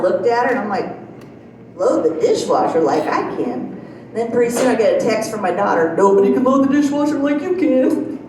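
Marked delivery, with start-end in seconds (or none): 1.8-3.8 lower-pitched questioning echo, rising at the end; incredulous echo. Retold or imitated. Echoes mom's words back as question. 6.0-8.2 mock singsong imitation of mom's compliment; teasing parrot. Daughter's teasing text of mom's words.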